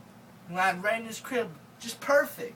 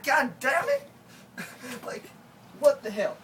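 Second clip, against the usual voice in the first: exasperated; 5 semitones higher, 5 dB louder than usual. Real, not acted. Exasperated outburst.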